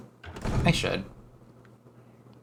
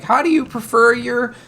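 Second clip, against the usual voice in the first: higher, louder quiz-host voice; a higher, chirpy questionnaire voice; mimicking game prompt; 10 semitones higher, 4 dB louder than usual. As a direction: read it like a bubbly game question. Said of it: "where it's like".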